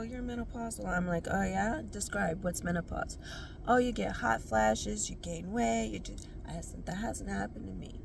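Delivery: ordinary delivery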